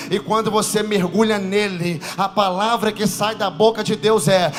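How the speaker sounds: loud sermon that swells into high, half-sung shouts; preaching at full force. Acted. Pastor telling Caruaru their past is paid, building to a shouted sung sermon. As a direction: pick up the energy and ride it up to a sung, shouted finish.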